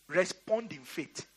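usual speaking voice

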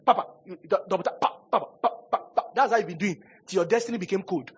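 ordinary talk

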